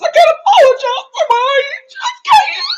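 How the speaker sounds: laughing while faking a wail; imitating crying. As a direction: ham up her tearful apology. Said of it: "So Jenny starts crying".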